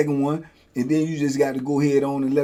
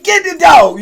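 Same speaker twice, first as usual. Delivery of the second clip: higher, louder desperate yell; desperate shout; 14 semitones higher, 11 dB louder, slower than usual. Retold or imitated. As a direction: scream for help with the dog. Yelling at guards during fight.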